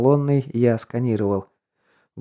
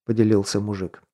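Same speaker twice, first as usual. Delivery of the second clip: plain reading voice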